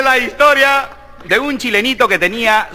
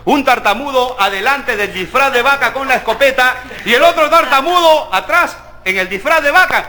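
ordinary talk, nothing marked

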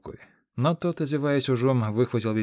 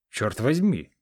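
exclaimed; 2 semitones higher, 5 dB louder, slower than usual. A narrator's reading. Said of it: "воскликнул великан".

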